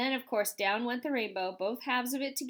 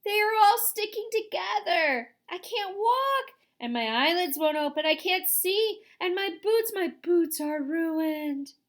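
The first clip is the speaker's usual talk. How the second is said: high, loud, slow wailing complaint; distressed cry; 7 semitones higher, 6 dB louder, slower than usual. A narrator's reading. Centipede wailing that his legs and boots are stuck. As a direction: whine it out in misery. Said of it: "he cried".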